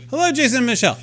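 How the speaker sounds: a bad South African accent, high and sing-song. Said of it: "I can't do a South African accent to save my life".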